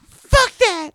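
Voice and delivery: very high, loud screech; bad singer imitation. Mocking an absent singer, very high screeched imitation. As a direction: screech it as an over-the-top imitation. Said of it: "He doesn't even sound like that".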